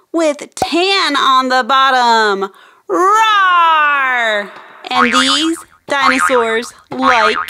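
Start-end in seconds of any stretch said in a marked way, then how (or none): 2.9-4.5 mock dinosaur roar. Hostess voicing a toy dinosaur for kids, playful roar. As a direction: growl like a friendly dinosaur.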